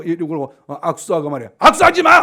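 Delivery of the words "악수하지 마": loud, high burst of rebuke; scolding shout. Acted. Preacher warns men and women off handshakes, shouting. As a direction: shout it as a sharp ban.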